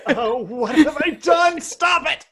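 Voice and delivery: high, slow, panicked shriek; mock panic. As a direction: wail it like a fan stuck to the floor. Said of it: "before everyone's like".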